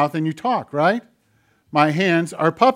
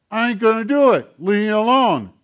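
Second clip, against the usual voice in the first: put-on higher, louder childish defiance; mock stubborn person telling God no; stubborn refusal; 7 semitones higher, 5 dB louder than usual. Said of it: "get really stubborn".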